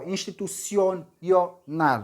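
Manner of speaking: "institucional" slowly, dwelling on the "o"; slow teaching version of "institucional", split before "nal"; drawn-out syllables. Shown as a demonstration. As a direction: stretch and repeat the O in "institucional". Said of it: "na letra O".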